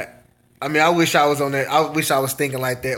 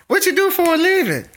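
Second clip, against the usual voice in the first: much higher put-on voice of a nosy country host; mock host; 14 semitones higher than usual. Streamer imitating a country host quizzing a newcomer, high mock voice. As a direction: ask it in a high, teasing imitation of a local.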